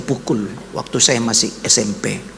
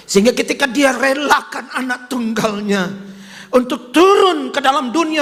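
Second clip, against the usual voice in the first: higher, impassioned sermon voice; fervent preaching; 10 semitones higher than usual. Acted. Preacher proclaims the Father's love to the congregation, fervent. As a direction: preach it with rising fervour.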